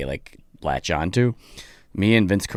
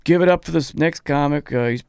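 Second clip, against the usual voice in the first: mock dismissive host voice, higher; lightly voiced rude host, pitched up; mocking the bad host; 9 semitones higher, 5 dB louder than usual. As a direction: sneer the intro like a rude emcee. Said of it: "he's like".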